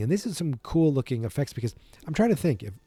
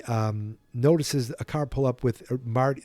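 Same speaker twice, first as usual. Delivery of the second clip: ordinary talk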